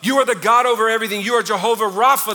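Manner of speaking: loud, rising, preaching at full force over music; fervent worship prayer. Shown as a demonstration. Preacher modelling praise for the congregation, loud fervent prayer. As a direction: pour out praise, building and calling out the names of God.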